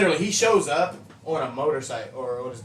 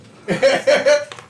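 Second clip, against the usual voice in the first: loud high laugh; 12 semitones higher, 12 dB louder than usual. Real, not acted. Speaker laughs hard.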